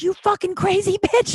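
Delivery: high-pitched imitated rage; retold furious outburst. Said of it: "Like, he's so mad".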